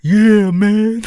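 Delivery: put-on loud, high "Yeah, man" in a thick non-native accent; thick foreign accent. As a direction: imitate your own thick accent from back then. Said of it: "with my thick accent at that time".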